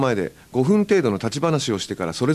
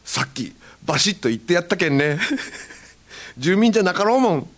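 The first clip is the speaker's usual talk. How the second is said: higher Hakata brag with laughter; boastful Hakata; 6 semitones higher than usual. Retold or imitated. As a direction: brag to a friend in Hakata dialect. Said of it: "いつもの散歩仲間のようで". (得意げな博多弁)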